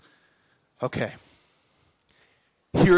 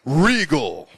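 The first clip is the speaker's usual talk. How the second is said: pompous, lower regal voice; regal king; 4 semitones lower, 4 dB louder than usual. Shown as a demonstration. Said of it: "He's gotta be very".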